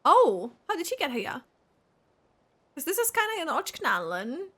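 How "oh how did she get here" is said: normal talking voice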